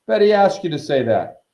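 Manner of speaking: high, loud jeer; derisive yell. A narrator's reading. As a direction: call out the accusation mockingly. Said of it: "yelled Sir Patrick's head".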